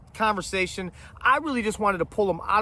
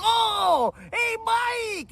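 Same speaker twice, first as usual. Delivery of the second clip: bright shouted hey across the street; calling out; much slower than usual. Retold or imitated. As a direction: sing out a fake cheery greeting to a neighbor. Calling out to Mike passing.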